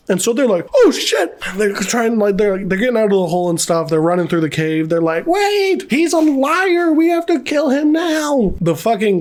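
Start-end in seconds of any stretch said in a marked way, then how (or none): 0.7-1.4 very high-pitched imitation of panic; mock alarm. Voicing soldiers' alarm at the discovery. 5.3-8.5 high, drawn-out imitated yell, only slightly raised; mock urgent warning. Voicing urgent warning shouted through cave.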